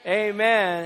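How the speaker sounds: rousing Amen to the congregation; loud amen. Shown as a demonstration. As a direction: lead the crowd with a loud Amen. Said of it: "everybody said as loud as you can".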